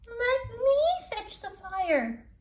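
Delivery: very high, slow tiny spider voice; teeny voice. Acted. Grandmother Spider speaks from the back, teeny and high. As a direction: squeak it out like a tiny old spider. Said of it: "a teeny voice spoke".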